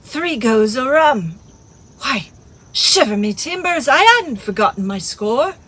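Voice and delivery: loud, high exclamations; hammed-up alarm at himself; comic dismay. A narrator's reading. Silver aghast at the forgotten rum bill, loud.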